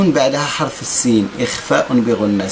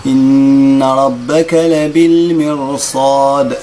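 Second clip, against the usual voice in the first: slow, melodic tajwid chanting; Quran recitation; 2 semitones higher, 6 dB louder, much slower than usual. Shown as a demonstration. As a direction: chant the verse slowly. Recites the verse. (ترتيل)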